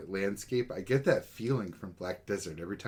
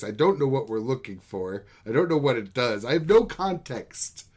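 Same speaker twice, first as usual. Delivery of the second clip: louder, higher exasperated rant; building indignant disbelief, louder; 4 semitones higher, 6 dB louder than usual. Real, not acted. Ranting about the betrayal.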